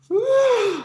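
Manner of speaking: high, loud exhale; relieved whew. Real, not acted. Guest about to introduce himself, steadying whew. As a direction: blow out a big nervous breath.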